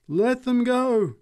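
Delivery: slow, high, loud exhortation; drawn-out revivalist call; preacher's cry. A narrator's reading. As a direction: cry it out slowly to the crowd. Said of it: "she cried".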